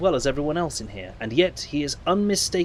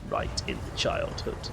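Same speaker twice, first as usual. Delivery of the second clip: whisper; 7 dB quieter than usual. Acted. Whispered take of same joke.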